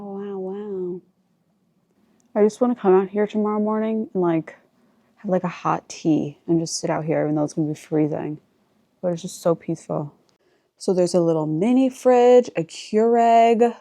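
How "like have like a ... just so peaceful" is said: normal talking voice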